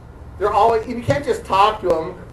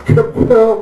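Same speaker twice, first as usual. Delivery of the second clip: louder laughing mock explosion; kaboom sound effect; 6 dB louder than usual. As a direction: blurt the blast as her reply. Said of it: "I just do the kaboom".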